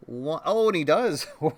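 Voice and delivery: excited. Real, not acted. Kick-out during pin count.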